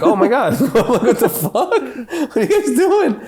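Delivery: high, loud, laughing mock alarm; mock shocked. Caught drinking the water at the gate, squealing in mock shock. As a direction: squeal it out like you got caught.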